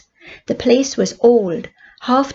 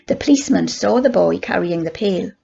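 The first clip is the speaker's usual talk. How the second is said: usual speaking voice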